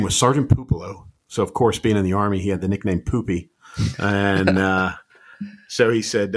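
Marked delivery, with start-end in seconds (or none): none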